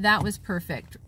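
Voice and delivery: ordinary delivery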